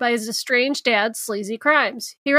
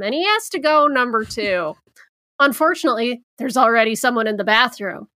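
normal talking voice

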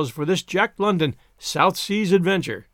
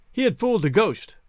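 usual speaking voice

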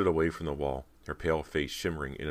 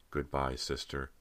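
slow, softer, cold farewell; 5 dB quieter than usual. A narrator's reading. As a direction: say it slowly with a chill. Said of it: "Callie replied coldly".

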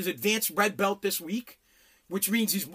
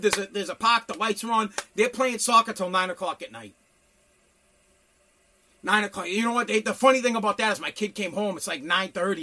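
ordinary delivery

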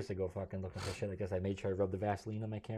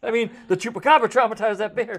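high, loud Southern backtracking; hammed-up Southern excuse; blustering Southern dad; 12 semitones higher, 14 dB louder than usual. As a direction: correct yourself loudly as a comic Southern dad. Character covers his slip, acted.

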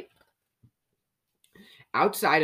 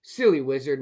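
plain reading voice

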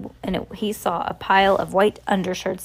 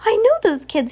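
high-pitched excited outburst; excited cry; 13 semitones higher, 4 dB louder than usual. A narrator's reading. Shopkeeper recognizes the wanted children, excited cry. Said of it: "he cried".

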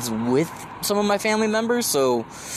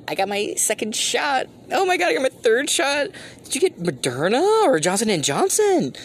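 mocking; 9 semitones higher than usual. Mocking coworkers.

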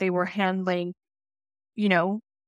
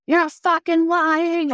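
higher, louder, slow furious outburst; furious yell; 8 semitones higher, 5 dB louder, much slower than usual. Retold or imitated. Police officer blowing up at a lying teen, angry yell. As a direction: blow up at her, spitting each word. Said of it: "just blew up on me and she was like".

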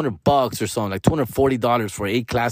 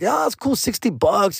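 much higher, breezy mock-casual; casual seller voice; 7 semitones higher than usual. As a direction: play it cool, pitched way up. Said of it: "And I like".

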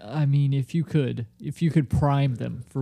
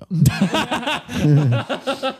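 loud laugh; 9 semitones higher, 3 dB louder than usual. Real, not acted. Laughing at the idea that nobody comes for bingo. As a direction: burst of high laughter.

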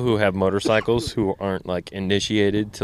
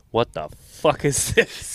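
slow baffled question; 6 semitones higher, slower than usual. Retold or imitated. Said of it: "I was like".

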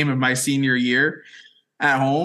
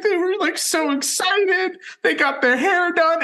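mock tearful girlfriend. Imitating his sobbing girlfriend Kate, much higher and teary. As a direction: squeak it out like she's about to cry. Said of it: "She's like".

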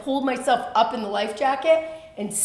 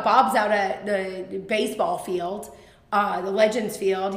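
normal talking voice

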